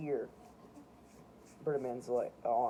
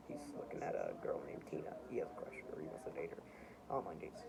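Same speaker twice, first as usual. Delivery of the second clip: whispering; 10 semitones lower, 11 dB quieter than usual. Real, not acted. Telling about a crush.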